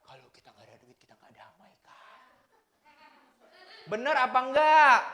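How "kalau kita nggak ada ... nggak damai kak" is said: whispered, anxious without money; worried whisper. Pretend. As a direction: hush it as a sad confession. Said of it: "kata orang dunia".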